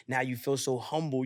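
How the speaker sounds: ordinary talk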